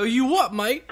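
high-pitched hammed-up Cockney; mock Cockney accent. Shown as a demonstration. Asking if the Brits talked so, mock Cockney accent. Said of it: "like, Cockney accents?".

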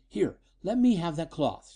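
high-voiced woman's urgent demand. A narrator's reading. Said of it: "she demanded".

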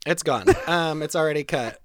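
ordinary talk

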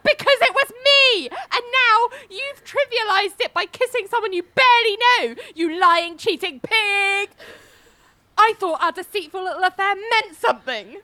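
high, loud, hammed-up outburst of betrayal; mock furious rant. Ali rants at cheating Billy about their affair, hammed-up yelling. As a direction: spit the insults out in mock fury.

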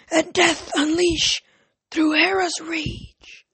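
high, slow, whispery snake-like oracle; raspy ancient voice. A narrator's reading. Oracle Rachel possessed, warning Jason, raspy ancient hiss. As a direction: hiss the prophecy out like an ancient serpent. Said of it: "The voice that came out was raspy and ancient".

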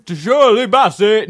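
loud, slow stab at a singer's voice. Acted. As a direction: try out the diva, loud and drawn out. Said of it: "How does [name] talk?".

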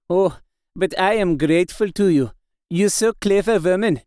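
put-on foreign-accented old professor, fervent thanks; accented foreign doctor, grateful. Doctor thanks Mina after reading her diary, fervent accented voice. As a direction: play the foreign doctor overcome with gratitude.

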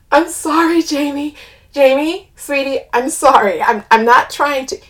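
loud apology through laughter; laughing apology. A narrator's reading. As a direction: apologise while trying not to laugh. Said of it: "burst into laughter".

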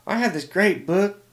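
slow, loud, high southern sister; southern accent. Retold or imitated. Missionary sister offering a book, in a high southern accent. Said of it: "here he comes with the southern accent".

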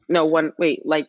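ordinary talk, nothing marked

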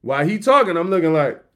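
normal talking voice

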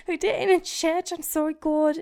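high, loud mock British confession; British accent. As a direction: squeal it in a hammed-up British accent. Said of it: "should I do it in a British accent?".

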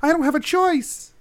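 high, wailing plea; desperate, high-pitched remorseful cry; anguished apology. Acted. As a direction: beg forgiveness in a distraught high voice. Father wails back in apology.

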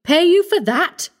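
indignant, startled outburst; cried out in shock. A narrator's reading. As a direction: recoil at the very idea. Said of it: "he cried".

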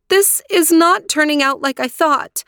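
high-pitched dismayed protest; distressed cry. A narrator's reading. Aurora protesting to her aunts about the marriage, crying out. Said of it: "cried Aurora".

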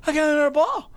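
put-on high, thrilled kid's cry; excited kid. Seven-year-old shows off his second ball, high and excited. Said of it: "He's like".

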